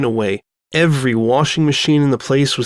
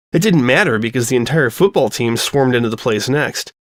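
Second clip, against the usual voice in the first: usual speaking voice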